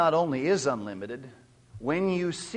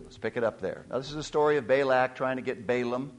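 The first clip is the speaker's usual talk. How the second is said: ordinary talk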